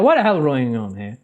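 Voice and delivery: ordinary delivery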